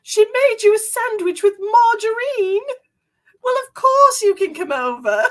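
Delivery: high, loud mock-British phone voice; British TV lady. Host quoting a British TV show's phone scene, exaggerated British accent. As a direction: imitate an outraged British lady on the phone, hammed up. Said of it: "this lady picks up the phone".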